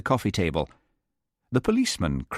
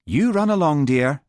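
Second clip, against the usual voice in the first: kindly Heather; 7 semitones higher, 4 dB louder than usual. Acted. Heather sends John off.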